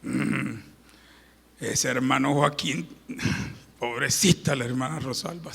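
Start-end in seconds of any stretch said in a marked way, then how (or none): none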